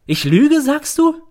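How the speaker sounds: high, loud, rising disbelief; a mother outraged at her son; shrill mother. A narrator's reading. Mother to son in court, shrill and outraged.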